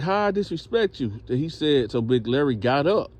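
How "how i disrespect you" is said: high-pitched imitated protest; puzzled protest. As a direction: plead innocence in a raised pitch. Acted protest to Larry.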